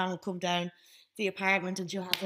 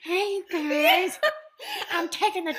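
mock Stifler's mom; 11 semitones higher, 4 dB louder than usual. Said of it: "I'm like Stifler's mom".

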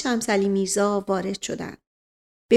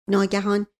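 ordinary delivery

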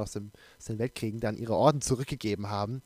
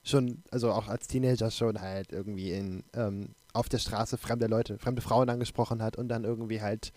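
ordinary talk

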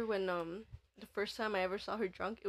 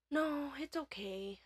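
slow, drawn-out tipsy sweetness; drunk sister reassuring; slower than usual. Retold or imitated. Drunk sister declining help at bedtime, slow woozy affection.

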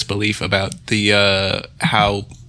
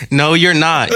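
louder, higher protest; firm denial; 6 semitones higher, 5 dB louder than usual. Real, not acted. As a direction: shut it down firmly. Protests the news.

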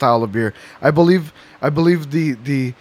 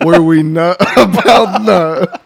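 laughing; 3 semitones higher, 4 dB louder, slower than usual. Pretend. Mock podcast tagline while laughing.

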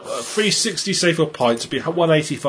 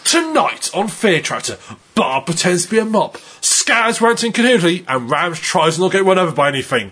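mock TV announcer, pitched up in places; proper announcer; 2 semitones higher than usual. Friend putting on an announcer voice for a listener's comment, mock announcer. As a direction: announce it grandly while holding back laughter. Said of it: "as if you're a proper announcer".

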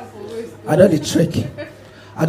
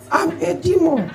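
high demon voice; 8 semitones higher, 5 dB quieter than usual. Acted. Demon taunting the trekking comedian, high and soft.

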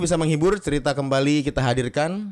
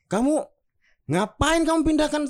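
much higher, indignant scolding; the neighborhood head scolds the boy about the grass, much higher and outraged; 11 semitones higher than usual. Acted. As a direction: tell the boy off in a high outraged voice. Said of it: "bilang Pak R T".

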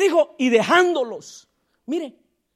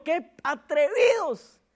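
drawn-out echo; 2 semitones higher, 3 dB quieter, slower than usual. Shown as a demonstration. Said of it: "diga conmigo".

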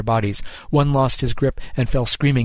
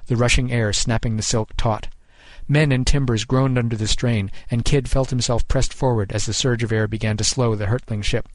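ordinary delivery, nothing marked

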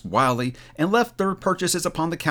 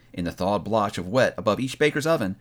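ordinary delivery

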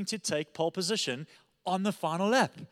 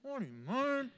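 high, strangled disbelief; mock outrage through a tight throat; fuming; 7 semitones higher, 8 dB quieter than usual. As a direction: seethe it out, high and soft. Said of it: "like, fuming".

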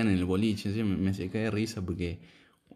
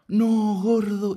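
mock crying; 13 semitones higher, 6 dB louder, much slower than usual. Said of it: "dice que me largo a llorar".

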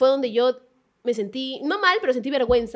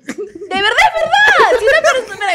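high, loud, drawn-out protest; indignant retort; 8 semitones higher, 5 dB louder, slower than usual. Real, not acted. Defending herself against the blame, indignant. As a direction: protest loudly and slowly, half laughing.

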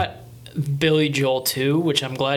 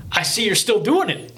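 really corny, higher-pitched put-on voice; 7 semitones higher than usual. Host imitating the rapper's ad-lib to [name], corny voice. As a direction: ham it up with a corny, high lilt. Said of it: "in this really corny voice".

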